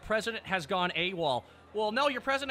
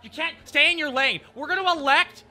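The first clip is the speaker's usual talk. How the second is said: louder, higher mock-scolding of critics; angry mocking rant; 7 semitones higher, 11 dB louder than usual. Real, not acted. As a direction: rant back at the critics, loud and scornful.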